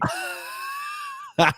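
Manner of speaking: very high cackle; real helpless high laughter; laughing. Real, not acted. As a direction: burst out in a high, squealing laugh. Laughs at co-host's livestream remark.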